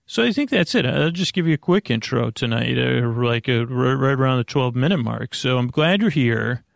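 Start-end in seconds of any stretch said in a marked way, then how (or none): none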